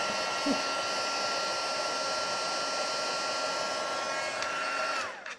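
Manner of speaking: laughing. Real, not acted. Laughs at forgetting the camera.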